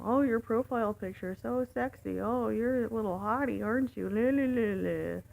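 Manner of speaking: sing-song imitation of a creepy flirt; mock flirting. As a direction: imitate a drunk man hitting on someone. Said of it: "then he starts hitting on me".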